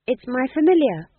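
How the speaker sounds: high, loud defensive reply; on the defensive, high-voiced. A narrator's reading. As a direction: defend yourself, voice pitched high. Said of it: "she said defensively".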